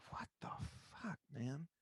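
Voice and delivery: hushed, whispered what the fuck; quiet whispered second thoughts; whispered self-doubt. Retold or imitated. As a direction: breathe it to yourself in disbelief. Inner voice looking back.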